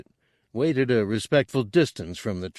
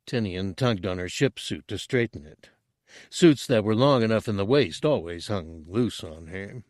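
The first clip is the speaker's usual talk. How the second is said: plain reading voice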